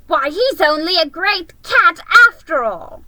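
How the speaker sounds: high, loud mocking creature voice; jeering cry. Enemy creature jeering at shorn Aslan, high and loud. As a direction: jeer in a sneering creature voice. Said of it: "cried one".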